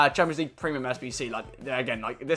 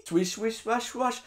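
soft, higher whooshing swish-swash noises; mock whoosh sounds; 7 semitones higher, 6 dB quieter, slower than usual. Revisiting old FIFA packs for viewers, soft whooshing sounds. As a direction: hush it like a pack animation sweeping past.

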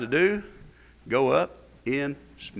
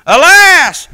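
alas cried out much louder and higher; loud wail; 13 semitones higher, 15 dB louder than usual. Acted. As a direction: throw the second alas out as a loud wailing cry. Lament repeated louder.

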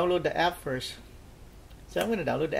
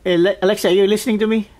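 calling out to Alexa; 4 semitones higher, 10 dB louder, much faster than usual. Real, not acted.

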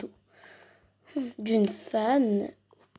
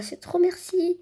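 ordinary talk, nothing marked

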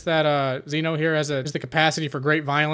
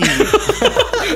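high, loud laughter; laughing; 5 dB louder than usual. Real, not acted. Player laughing at the dancing boast, high and loud. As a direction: burst out laughing.

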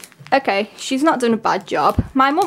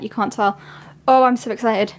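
ordinary delivery

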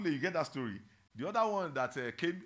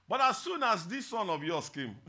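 loud, raised-pitch grievance; angry older son; 6 semitones higher, 8 dB louder than usual. Acted. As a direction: protest to the father, loud and indignant. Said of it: "He was angry".

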